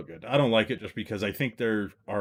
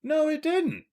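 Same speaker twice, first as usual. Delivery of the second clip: very high, squeaky protest; high-pitched contradiction; slower than usual. Real, not acted. Co-host disputing friend's claim, very high protest.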